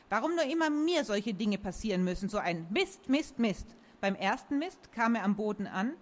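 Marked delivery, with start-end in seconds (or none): none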